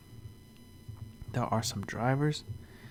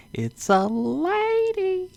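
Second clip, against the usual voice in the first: shrill falsetto squeal, stretched slow; mock squeaky voice; 9 dB louder, much slower than usual. Mocking the show's host, squeaky high falsetto.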